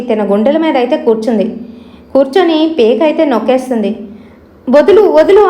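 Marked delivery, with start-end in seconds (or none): 4.7-5.4 higher, louder plea to be let go; struggling cry. Acted. Pinned sleeper begging the ghost to let go, struggling cry. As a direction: cry out, trying hard to scream. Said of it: "గట్టిగానైతే అరబోతుంది".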